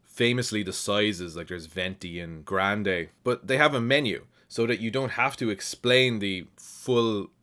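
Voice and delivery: normal talking voice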